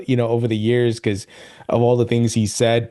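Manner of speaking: ordinary talk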